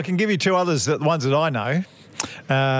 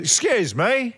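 broad Aussie twang, pitched up; hammed-up Australian accent, raised pitch; ocker Australian; 5 semitones higher than usual. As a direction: ask it like a real ocker Australian. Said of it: "in a real locker Australian voice".

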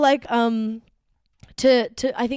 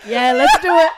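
laughing; 8 dB louder than usual. Retold or imitated. Retold eager reply, laughing.

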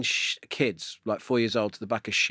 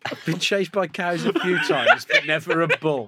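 laughing; 3 semitones higher than usual. Real, not acted. Laughing retort.

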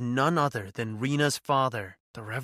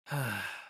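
sigh; 4 dB quieter than usual. A narrator's reading. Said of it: "I sighed".

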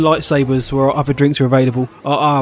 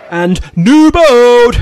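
excited shout; 10 semitones higher, 7 dB louder, much slower than usual. Acted. Excited cheer of a friend's name.

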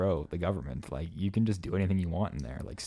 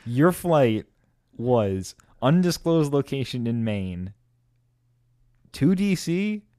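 louder, climbing high on each stop; incredulous questioning; 9 semitones higher, 6 dB louder, slower than usual. Real, not acted. Co-host checks friend's odd flight route, incredulous. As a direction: lay out the route leg by leg, pitch jumping up.

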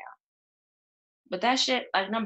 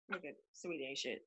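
ordinary talk, nothing marked

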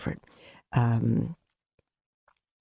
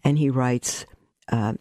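ordinary delivery

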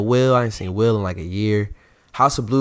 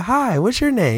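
lady's greeting; 12 semitones higher than usual. Retold or imitated. Voicing the lady meeting Bomani.

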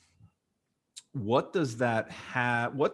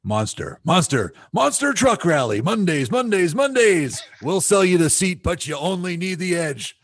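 booming radio ad announcer, much higher and louder; monster truck ad; 7 semitones higher, 4 dB louder than usual. Shown as a demonstration. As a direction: shout it like a monster truck rally promo. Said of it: "let's hear your radio voice".

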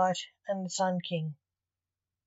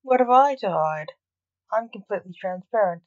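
defiant; 8 dB louder than usual. A narrator's reading. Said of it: "defiant side".

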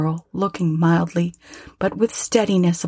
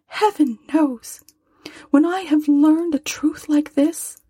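put-on higher, gentle voice of a grieving young woman; young girl, sorrowful; 11 semitones higher than usual. A narrator's reading. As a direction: lift into a tender girl's voice, close to tears.

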